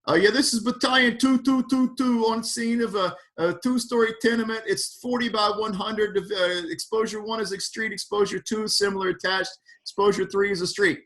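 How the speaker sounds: New York fire chief radio report; New York accent. Retold or imitated. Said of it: "I'm getting ready to give you a New York accent".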